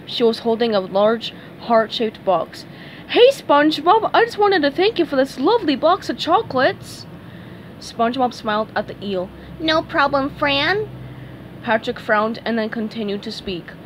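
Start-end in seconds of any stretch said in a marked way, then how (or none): 3.1-7.1 high, lilting lady eel voice; high-pitched, gushing thanks; grateful eel character. Acted. Pink eel character thanks SpongeBob. 9.6-10.9 high-pitched cartoon reply; light, high character voice; SpongeBob character. SpongeBob answers the eel.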